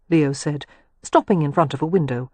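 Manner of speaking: ordinary delivery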